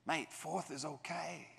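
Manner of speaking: soft, slow reassuring voice of a big man; gentle consoling. Retold or imitated. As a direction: comfort him gently. Big officer consoling him.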